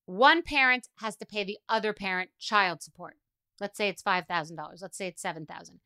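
normal talking voice

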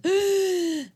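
loud, high theatrical gasp; mock shocked gasp. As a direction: gasp sharply as if scandalized. Imitating her gasp at ripped tag.

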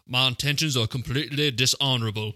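lightly put-on Southern gentleman; mild Southern accent. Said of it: "Notice that you put a Southern accent on".

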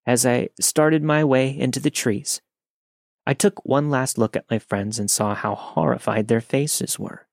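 normal talking voice

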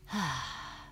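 soft contented sigh; a little girl's happy sigh in the chair. Acted. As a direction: let the breath out as she settles. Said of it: "She sighed".

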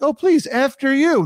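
mock polite. New Yorker pretending politeness in line, mock sweet. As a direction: put on fake manners. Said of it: "It sounds like it's very polite".

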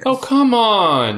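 slow, drawn-out disbelief; fed up and incredulous; exasperated. Real, not acted. Exasperated at lab news.

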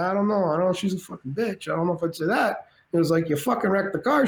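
De Niro doubting the story; De Niro impression. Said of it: "De Niro people".

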